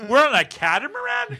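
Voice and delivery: incredulous, shooting up high; surprised. Retold or imitated. Retelling surprises on the trip.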